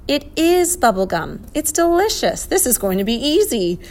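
high, eager and thrilled; excited boy with leaping pitch; delighted excitement. Acted. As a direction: relish the happy discovery. Boy thrilled by the gum taste.

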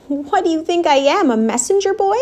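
high-pitched indignant grumble; grumbling. A narrator's reading. Rat balking at being sent back to the dump, grumbling. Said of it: "grumbled the rat".

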